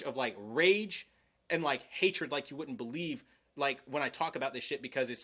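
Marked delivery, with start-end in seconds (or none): none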